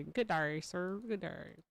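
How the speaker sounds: mock dismissive farewell. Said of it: "I'm gonna have to say".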